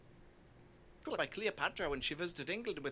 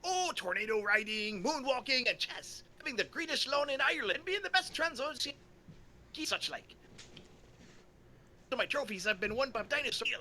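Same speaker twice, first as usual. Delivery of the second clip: put-on boastful Irish accent; 5 semitones higher than usual. O'Leary boasting.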